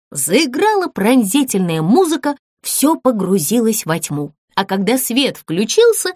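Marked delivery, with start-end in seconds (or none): none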